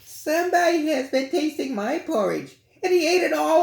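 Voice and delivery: put-on very high, squeaky baby bear with a whimper; tiny bear voice close to tears; wee little bear. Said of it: "the wee little bear".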